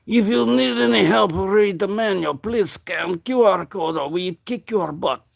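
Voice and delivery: playful fake Russian accent with a threat at the end; mock Russian accent. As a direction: read it like a joking Russian tough guy. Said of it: "I can speak Russian".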